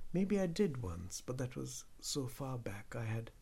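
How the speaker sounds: usual speaking voice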